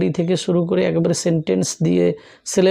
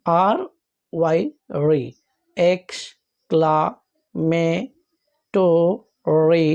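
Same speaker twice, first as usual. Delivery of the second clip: letters and syllables spoken apart with long pauses; teacher spelling out exclamatory slowly; x, ক্লা, মে, টো, রি each said alone with a pause; slower than usual. Shown as a demonstration. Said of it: "তাহলে এরকম বলতে হয়".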